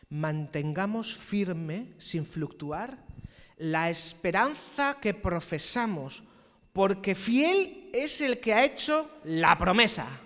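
very slow, rising to loud; slow scripture proclamation. Acted. Preacher proclaiming Hebrews to the church, slow and building. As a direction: stretch every word of the verse.

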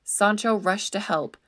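plain reading voice

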